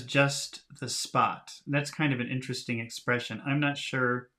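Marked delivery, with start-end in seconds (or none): 0.0-1.6 slow emphasis. Shown as a demonstration. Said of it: "Now notice that he says".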